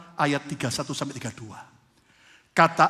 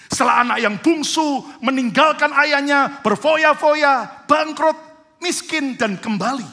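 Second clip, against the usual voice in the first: loud, high, driving sermon delivery; full-force preaching; 10 semitones higher, 10 dB louder than usual. Acted. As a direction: preach it with rising fire to the congregation. Preaching the parable loudly.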